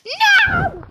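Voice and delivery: high loud shriek of no; anguished shout. Real, not acted. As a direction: scream it in dismay. Blown up.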